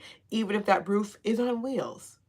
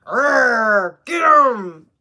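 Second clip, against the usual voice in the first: loud, raised growl of anger; mock angry growl; 2 semitones higher, 8 dB louder, much slower than usual. Reader retelling her anger, loud growl. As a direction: growl it out like you want revenge. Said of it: "I got really angry".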